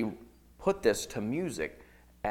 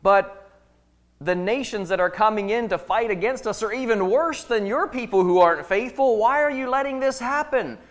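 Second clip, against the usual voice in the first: high, loud pleading cry; crying out to God; 9 semitones higher, 6 dB louder than usual. Acted. Prophet crying out to God, loud high plea. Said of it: "Habakkuk is crying out".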